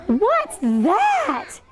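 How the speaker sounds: acted startled question with swooping pitch; gasped astonishment. Teacher voicing the gasping beach workers, acted astonishment. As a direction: ask it as a startled onlooker. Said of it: "The beach workers gasped".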